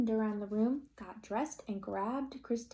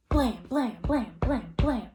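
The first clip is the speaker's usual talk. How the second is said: loud knocks; 3 semitones higher, 6 dB louder, slower than usual. A narrator's reading.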